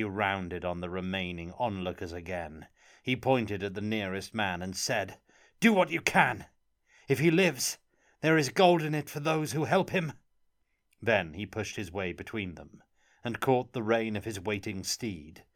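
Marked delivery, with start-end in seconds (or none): 5.6-10.1 loud, higher commanding call; urgent shout. Acted. Orfeo calls to onlookers.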